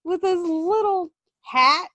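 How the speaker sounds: slow, drawn-out lovestruck sighing; mock dreamy swoon. Gushing to the audience about a wrestler, slow and dreamy. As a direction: melt over every word.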